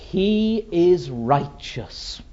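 slow, emphatic pronouncement in full voice; slow, weighty pulpit declaration; solemn declaration. Acted. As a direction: declare it as a verdict, word by word. God's verdict declared in sermon.

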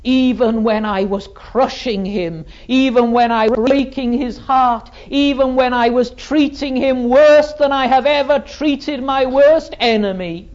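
loud, high, surging sermon climax; impassioned preaching. Acted. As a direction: build to a ringing cry. Preaches at full force.

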